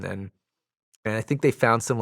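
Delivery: ordinary talk, nothing marked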